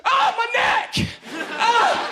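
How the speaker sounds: high loud pained cry; pigeon in pain. Acted. Pigeon home at night cries to family, high pained shout. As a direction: yell Ah, my neck like a hurting bird. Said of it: "opens the door and goes".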